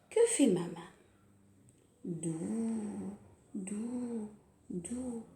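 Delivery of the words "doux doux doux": softly, tenderly; soft and gentle. Shown as a demonstration. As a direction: soothe like a caress. Gentle stroking rhyme.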